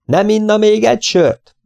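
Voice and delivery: high-pitched, projected offer. A narrator's reading. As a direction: call it out to someone hard of hearing. Offering beer to deaf old man. (emelt, magas hangon)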